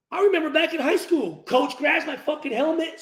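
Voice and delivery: ordinary talk, nothing marked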